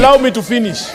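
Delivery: insisting loudly, high; shouting order order. Real, not acted. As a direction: shout to keep the floor. Refusing to yield the floor.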